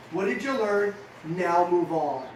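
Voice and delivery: normal talking voice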